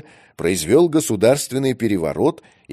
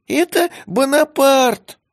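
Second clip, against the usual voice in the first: put-on much higher, squeaky young cat, slow and loud; squeaky kitten voice; 5 dB louder, slower than usual. A narrator's reading. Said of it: "раздался вдруг писклявый голос".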